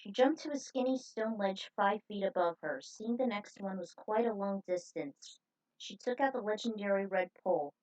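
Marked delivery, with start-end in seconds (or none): none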